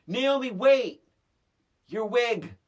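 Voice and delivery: loud, high shout across the room; shouted line. Retold or imitated. Co-star calling to Naomi from the stairs, loud and high. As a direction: yell it after her, urgent. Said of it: "these are the lines".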